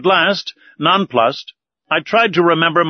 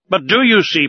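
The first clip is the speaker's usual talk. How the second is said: higher, heated protest; irritated appeal to the professor; irascible; 6 semitones higher, 3 dB louder than usual. A narrator's reading. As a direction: push back hotly. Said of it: "replied our irascible companion".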